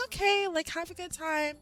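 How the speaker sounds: mock-polite goodbye, pitched well up; polite brush-off. Women at the bar seeing off a lingering man, overly polite.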